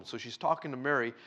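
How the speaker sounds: usual speaking voice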